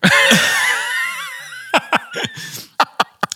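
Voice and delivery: high shrieking burst of laughter. Real, not acted. Cracks up at her own Maulwurfkuchen boast, shrieking laugh. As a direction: squeal with laughter at your own joke.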